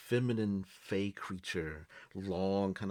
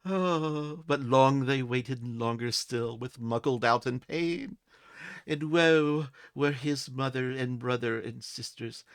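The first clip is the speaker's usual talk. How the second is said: fey creature reciting; 4 semitones higher, 5 dB louder than usual. Acted. Fey creature recites a charming rhyme to the adventurer, high and lilting. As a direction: chant the old verse like a fey enchantress.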